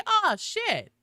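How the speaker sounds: ordinary talk, nothing marked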